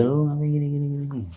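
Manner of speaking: high-pitched mock telling-off; retold cursing. Fellow camper joining in to berate the man, high retold scolding. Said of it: "dia juga ikut memaki dia".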